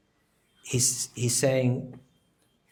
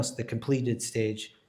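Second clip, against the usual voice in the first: ordinary delivery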